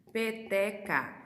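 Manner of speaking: "Peteca" very slow, as pe, te, ca; syllable by syllable. Shown as a demonstration. Teacher showing a three-syllable word, "Peteca" slowly broken into pe, te, ca. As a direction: sound out "Peteca" in three separate beats. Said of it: "Três sílabas".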